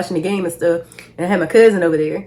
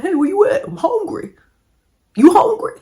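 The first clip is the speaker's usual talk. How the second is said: dad's voice mimicked much higher; imitating dad; 11 semitones higher than usual. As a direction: play dad calling on the way, pitched way up. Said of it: "He was like".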